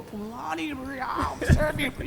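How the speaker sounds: high, slow mocking call "Phumlani"; mocking schoolkids. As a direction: imitate kids teasing him. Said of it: "I used to get it bad".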